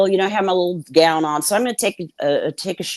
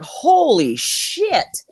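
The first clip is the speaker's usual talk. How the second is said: shocked; 6 semitones higher, much slower than usual. Retold or imitated. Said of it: "And I'm like".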